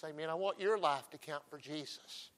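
normal talking voice

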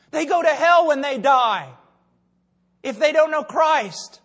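high, loud, slow and emphatic; impassioned preaching. Real, not acted. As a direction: drive each phrase home to the congregation. Preaches at full force.

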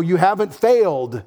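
ordinary talk

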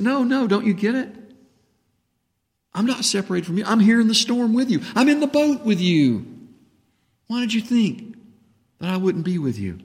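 high earnest pleading as Jesus; a hint of Jesus insisting; Jesus voiced. Preacher voicing Jesus.

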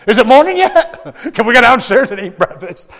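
put-on high, loud eager child voice; hyper sugared kid. Son waking dad at three in the morning, high eager kid voice. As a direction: bounce like a kid on Mountain Dew. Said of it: "Daniel's like".

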